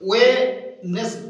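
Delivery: ওয়েনেস- drawn out slowly with no d; slow model syllable. Shown as a demonstration. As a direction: stretch ওয়েনেস- with the d silent. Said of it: "D here is silent". (ধীরে ধীরে ওয়েনেস-)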